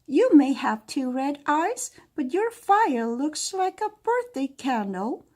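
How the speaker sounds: high, loud teasing princess; giggly mocking princess. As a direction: tease the dragon, unimpressed. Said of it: "Princess Finiver giggled".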